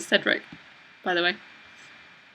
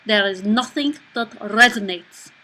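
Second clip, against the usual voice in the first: put-on French-speaking Belgian accent. Shown as a demonstration. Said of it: "so we'll do that accent".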